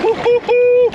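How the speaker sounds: high, loud whoop of delight; excited whoop. Real, not acted. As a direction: cheer at the gold in the pan. Cold river, finds a big flake.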